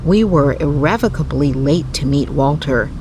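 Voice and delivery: ordinary delivery, nothing marked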